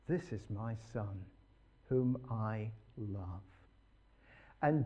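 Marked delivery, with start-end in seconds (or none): 0.1-3.4 hushed tender quote. Acted. Quoting the Father again.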